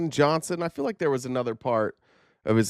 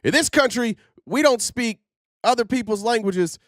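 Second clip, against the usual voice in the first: much higher mocking declaration; mock indignant yeller; 8 semitones higher than usual. Host mimicking someone yelling at a worker, mock indignant. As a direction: put on a high-pitched scolding American. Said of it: "yelling at somebody that".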